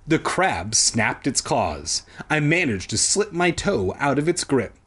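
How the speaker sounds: ordinary delivery, nothing marked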